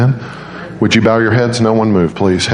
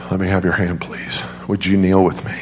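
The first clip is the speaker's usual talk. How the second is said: softer, lower, tender request; gentle invitation; 3 semitones lower, 5 dB quieter than usual. Retold or imitated. Father asking the nurse to kneel and pray, soft gentle invitation. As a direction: softly imitate a father inviting prayer.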